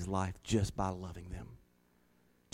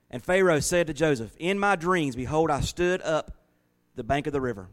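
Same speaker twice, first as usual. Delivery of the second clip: raised, measured Bible reading voice; scripture reading; 5 semitones higher, 4 dB louder than usual. Acted. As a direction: read the verse out like a preacher. Reads Genesis aloud to congregation.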